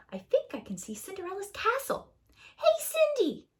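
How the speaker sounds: high, fast, calling out; excited Jack. Acted. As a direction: call up to her eagerly. Jack calls to Cinderella.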